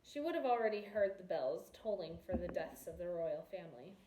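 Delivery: ordinary delivery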